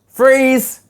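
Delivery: loud, high call to stop. Real, not acted. Teacher calling the reading class to freeze, loud. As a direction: shout it out to halt the class.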